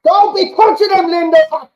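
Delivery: high, loud yell at a defender; shouting at the telly. Real, not acted. Fan yelling at Lindelof on the TV, loud and high. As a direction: bellow the name at the screen.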